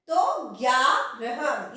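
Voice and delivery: ग्यारह drawn out, half ग gliding into य; careful slow ग्यारह; slow demo. Shown as a demonstration. Teacher slowly models ग्यारह for students. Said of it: "इसमें आधे ग का उच्चारण य के साथ".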